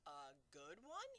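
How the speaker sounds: slow, disbelieving giant's echo; crying out in disbelief. Acted. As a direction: throw the question back in astonishment. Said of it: "he cried".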